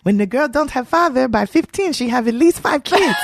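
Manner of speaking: a hint of a demanding father; lightly voiced nagging father.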